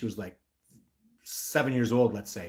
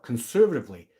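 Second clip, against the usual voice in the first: ordinary delivery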